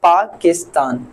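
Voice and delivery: पाकिस्तान in three easy beats, paa, kis, taan; पाकिस्तान as paa, kis, taan, without straining; unforced syllable split. Shown as a demonstration. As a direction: lightly separate the syllables of पाकिस्तान. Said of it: "जुबान को बोलते हुए भी मजा आए".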